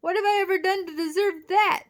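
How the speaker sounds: high, loud aggrieved cry; indignant demand. A narrator's reading. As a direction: wail at the injustice. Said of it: "demanded the giant slug".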